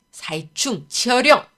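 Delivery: slow, loud spell-casting cry; shouted incantation. A narrator's reading. Scholar summoning a rat into the air, shouted incantation. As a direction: call out a spell, drawing it out. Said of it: "이라 외치며".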